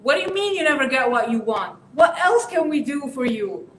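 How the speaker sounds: loud, exasperated parent retort; mock the irritated parent cutting in; indignant parent. Parent scolding the teen, indignant and loud. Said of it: "Don't, in that moment, be like".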